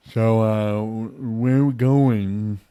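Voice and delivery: put-on loud, slow, slurred drunk voice; drunk passenger. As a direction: drag the words out like a drunk. Drunk passenger asking where to.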